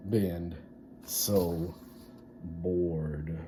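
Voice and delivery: slow, fading to a whisper; bored storytelling fade; dragged-out bored. A narrator's reading. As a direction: stretch each word as if dying of boredom. Drawn-out bored climax.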